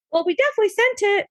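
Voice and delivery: high, quick mock brush-off; dismissive office voice. The GP office dismissing her claim, in a high brisk mock tone. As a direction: toss it off like a bored receptionist.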